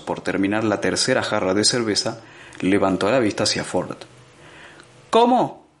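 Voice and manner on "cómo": sudden high startled question; jolted out of his beer, shooting up in pitch. Acted. Arthur caught off guard by Ford's odd question, startled.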